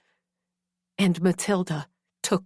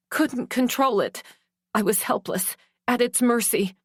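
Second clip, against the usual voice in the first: ordinary delivery, nothing marked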